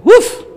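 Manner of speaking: a sharp high exhale of amazement; high surprised "uf". Retold or imitated. Pastor reacting to the mountain of food, astonished. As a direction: blow out an astonished "uf".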